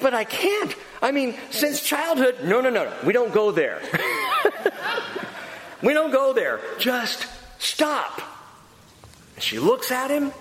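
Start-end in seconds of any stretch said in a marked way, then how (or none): 0.0-2.4 pleading protest. Retold or imitated. Patient protests to the therapist. 2.4-8.2 higher, insistent refusal; fed-up therapist refusing to listen; exasperated cut-off. Retold or imitated. Therapist cuts the patient off.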